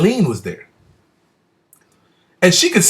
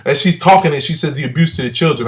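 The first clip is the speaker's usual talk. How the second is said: ordinary talk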